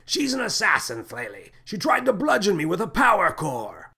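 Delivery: high, indignant yell; outraged high-pitched cry; shouting, nose held. A narrator's reading. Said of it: "was holding his nose and shouting".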